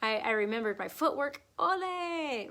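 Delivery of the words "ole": high, long-held "ole"; a playful, louder cheer stretched out; self-cheering ole. Acted. Cheering herself for remembering footwork.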